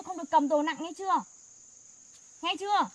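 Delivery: loud firm warning ending in a demand; loud scolding. Real, not acted. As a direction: drive the warning home loudly. Scolding sibling for heavy load.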